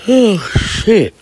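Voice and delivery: sudden high loud oh shit; surprised outburst. Real, not acted. Comedian caught off guard by the girl's offer, loud high blurt.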